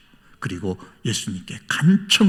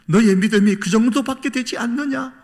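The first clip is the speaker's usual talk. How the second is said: higher-pitched mock scolding, pushed to a question; imagined rebuke; 9 semitones higher, 5 dB louder than usual. Jesus imagined chiding the disciples for little faith, rebuking. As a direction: scold them as a disappointed master would. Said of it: "그렇게 꾸짖으시는 예수님".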